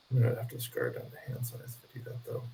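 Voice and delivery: quiet muttered aside; under the breath; muttering to himself. Real, not acted.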